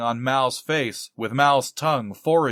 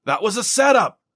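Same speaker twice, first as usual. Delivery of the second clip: a raised, higher indignant cry; yelled; 9 semitones higher, 5 dB louder than usual. Acted. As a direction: shout the denial, voice up high. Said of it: "Farthing yelled".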